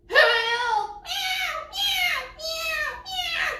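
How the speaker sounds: very high yowling cat voice; crying cat. Acted. Cat under attack crying for help, high yowl. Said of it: "cried the cat".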